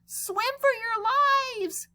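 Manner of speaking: high, slow alarmed yell; panicked shout. Acted. Joey warning the playground of the giant squid, high panic. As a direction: warn everyone in panic.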